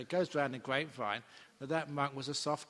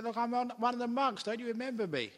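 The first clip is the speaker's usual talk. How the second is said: urgent plea; 10 semitones higher, 4 dB louder than usual. Retold or imitated. Naked monk pleading at gate.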